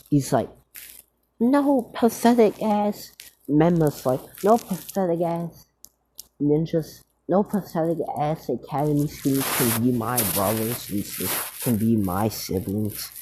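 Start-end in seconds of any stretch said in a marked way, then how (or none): 1.4-3.1 high, slow, scornful; scornful high sneer; contemptuous sneer. Acted. Menma insults siblings. 4.4-13.1 mild mock contempt; lightly voiced sneer at the siblings. Menma insults siblings.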